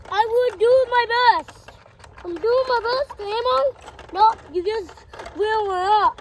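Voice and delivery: loud, eager play voices for the toys; child voicing toy trucks in a rescue game, loud and eager; toy characters at play. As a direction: call out the toys' lines as a rescue game. Loud toy role-play dialogue.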